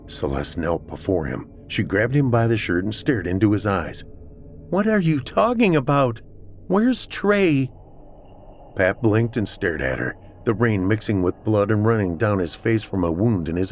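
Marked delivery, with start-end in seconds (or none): 4.7-7.7 high-pitched urgent questioning; demanding woman. A narrator's reading. Celeste gripping Pat's shirt and demanding answers, urgent. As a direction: press him for an answer, voice raised high.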